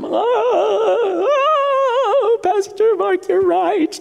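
very high, exaggerated wail; mock weeping. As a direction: play an emotional wreck bawling in agreement. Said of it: "walking around weeping all the time".

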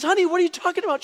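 high, loud, fast acted question; bewildered dad. Dad to his crying daughter, baffled high question. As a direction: blurt it out in comic confusion.

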